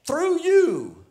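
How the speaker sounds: high and loud, ringing out; preacher voicing the angel, booming; loud proclamation. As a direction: proclaim it like a divine promise.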